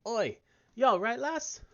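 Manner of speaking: put-on a rough, hearty Irish accent, mostly held. Brennan the peddler waking the lass on the ship, in an Irish accent. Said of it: "I think it's Irish".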